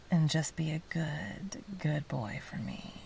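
ordinary talk

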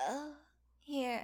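normal talking voice

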